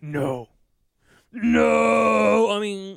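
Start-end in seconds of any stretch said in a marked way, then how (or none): 0.0-0.5 low, restrained villain's no; understated deep mock no; Darth Vader. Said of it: "you do a bad impression". 1.3-2.5 loud, high drawn-out bellow; hammed-up villain's howl of no; Darth Vader. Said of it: "you do a bad impression".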